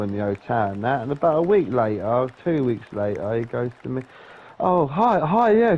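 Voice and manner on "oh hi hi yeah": high-pitched, lightly acted hello; imitated eager greeting. Evicted neighbour asking for help, imitated as an eager greeting. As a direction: imitate an over-keen neighbour, voice lifted.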